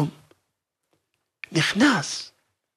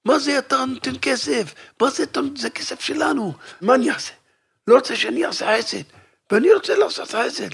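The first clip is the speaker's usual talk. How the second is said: mild mock yell; 6 semitones higher than usual. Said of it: "וגם צועק".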